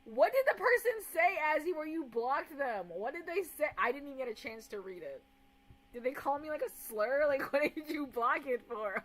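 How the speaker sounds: loud indignation. Real, not acted. Streamer asking a mod why a comment was blocked, loud indignation. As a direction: demand answers at full volume.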